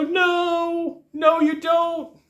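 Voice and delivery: high, slow whining no; whiny rep. Retold or imitated. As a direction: whine it back at them. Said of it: "you're gonna go".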